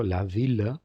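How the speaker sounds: VILLE ending in a sounded L, like "veel"; IL with hard L. Shown as a demonstration. Teacher showing students an exception to I doble L, VILLE with a sounded L. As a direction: say VILLE with a clear final L, no Y glide. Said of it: "se pronuncia como, como il".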